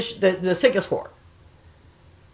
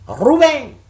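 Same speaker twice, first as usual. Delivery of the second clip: high, loud call of a friend's name; 8 semitones higher, 5 dB louder than usual. Pretend. Woman fondly hailing an absent friend, calling out. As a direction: sing out the name as if hailing him.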